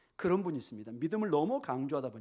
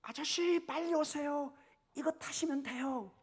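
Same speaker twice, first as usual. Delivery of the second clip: high, drawn-out call to a man some way off; calling rescuer; 11 semitones higher, slower than usual. Retold or imitated. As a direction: urge him over in a high calling voice. (높은 목소리로 부르는 구조대원)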